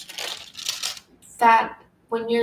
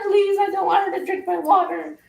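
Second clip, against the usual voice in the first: high, pleading little dog voice; voicing the dog; 8 semitones higher than usual. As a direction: speak for the shaky dog, begging. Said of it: "He's like".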